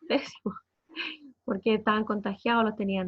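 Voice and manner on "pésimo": pésimo said through a short laugh; laughing repeat. Real, not acted. Repeating how badly they were treated, laughing. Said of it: "lo atendieron pésimo".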